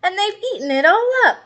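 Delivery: put-on high, fast child complaint; a little kid tattling, quick and high; whiny child voice. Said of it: "said the little person".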